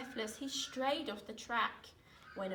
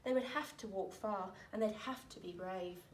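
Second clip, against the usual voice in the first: plain reading voice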